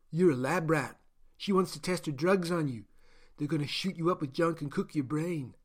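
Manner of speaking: caged man blurting a warning; blunt Gaian prisoner. Acted. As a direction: warn him bluntly from the cage. Gaian man warns the captive.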